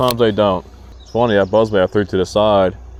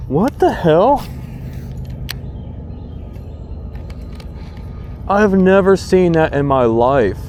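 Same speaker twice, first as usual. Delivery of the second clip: stunned disbelief; 7 semitones higher than usual. Real, not acted. Angler watching a bigger fish attack his catch, stunned. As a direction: talk in shocked wonder.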